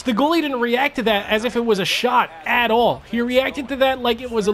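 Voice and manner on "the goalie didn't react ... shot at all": ordinary delivery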